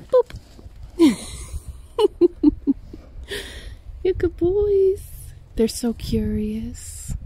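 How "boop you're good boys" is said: squeaky praising a dog. Real, not acted. Praising animals.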